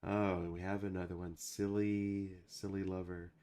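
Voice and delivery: usual speaking voice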